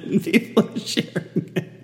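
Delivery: words half lost in airy laughter; real laughter breaking through; laughing. Real, not acted. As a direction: giggle the words out. Laughing over fake photo.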